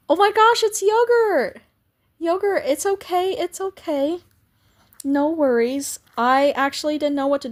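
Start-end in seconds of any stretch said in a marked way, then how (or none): none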